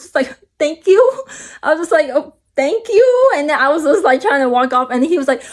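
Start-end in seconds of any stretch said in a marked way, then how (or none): 0.6-1.2 higher, louder, nervous little thank-you; nervous reply to a creepy stranger's compliment. Retold or imitated. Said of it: "I was nervous". 2.1-3.3 higher, slow, drawn-out nervous thank-you; nervous reply to a creepy stranger's compliment. Retold or imitated. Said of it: "I was nervous".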